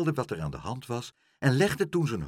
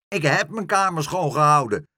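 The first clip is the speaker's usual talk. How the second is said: raised, loud, high protest; protesting yell; 7 semitones higher, 7 dB louder than usual. A narrator's reading. Pluk denies his room was dirty, protesting yell. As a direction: yell back in self-defence. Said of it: "schreeuwde Pluk".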